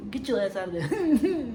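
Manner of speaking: chuckling through the words; laughing. Real, not acted. Telling the story and cracking up, laughing.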